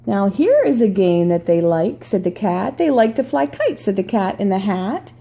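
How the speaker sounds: storybook read-aloud voice; reading to children. Acted. Reads picture book aloud.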